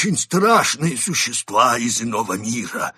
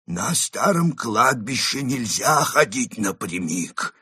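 slow, lecturing, higher voice; mentor-like tone; 5 semitones higher, 4 dB louder, slower than usual. A narrator's reading. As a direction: lecture them slowly like a mentor. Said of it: "менторским тоном заявил".

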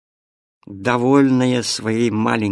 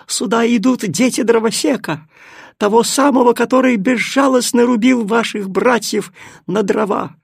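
high, loud agitated cat; urgent warning; 10 semitones higher, 5 dB louder than usual. Acted. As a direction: stir up the trees. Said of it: "крикнула запыхавшаяся кошка".

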